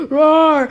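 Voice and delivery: short, loud pretend roar; sudden monster roar; scary monster roar. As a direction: roar to scare them. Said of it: "I'm finna scare them now".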